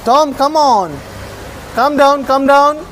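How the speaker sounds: high, urging pet call; real coaxing call in a high voice. Real, not acted. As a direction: call a pet down, pitched well up. Calling a pet down.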